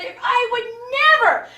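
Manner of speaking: loud, high, crying reproach; mock-weepy scolding; tearful mom. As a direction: wail it like a wounded mother. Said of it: "she said".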